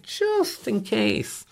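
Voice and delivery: high and slow like a sly hint; slow, high knowing aside. Retold or imitated. Calling a friend, he teases high and slow. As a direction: drag out "Just in case" high and slow, teasing. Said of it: "just said".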